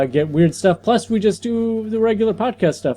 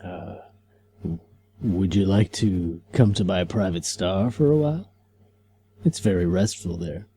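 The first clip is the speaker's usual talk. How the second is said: low, deep-voiced comic hero, lower than his own talk; Stardust; 8 semitones lower, 5 dB quieter than usual. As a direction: play the calm, grand space hero offering his star. S1 voicing Stardust in read.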